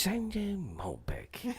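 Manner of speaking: breathy mock British whisper. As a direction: whisper it like a posh wine ad. Jokey British wine narration, whispered.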